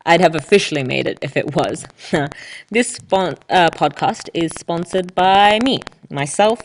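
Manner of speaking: ordinary delivery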